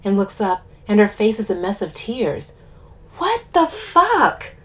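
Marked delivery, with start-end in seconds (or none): 3.2-4.5 high, drawn-out shocked exclamation; shocked gasp. A narrator's reading. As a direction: gasp it out in disbelief, slowly. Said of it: "I gasp".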